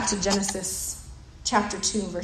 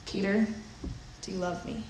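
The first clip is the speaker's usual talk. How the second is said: soft, hushed and gentle, a quiet plea; gentle question; 3 semitones lower, 10 dB quieter than usual. Retold or imitated. Jesus asking Peter in a soft, gentle hush. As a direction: ask it softly, as Jesus tenderly presses Peter. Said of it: "Jesus turns to Peter and says".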